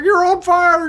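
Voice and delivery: very high, loud squeal of alarm; high squeaky mock rookie shouting a warning; frantic rookie. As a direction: yelp it like a panicked rookie. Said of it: "He's like".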